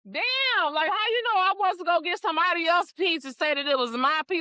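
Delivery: high, fast, half-joking protest; indignant outburst. Retold or imitated. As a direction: fire back, offended and amazed. Retelling indignant reply to mama.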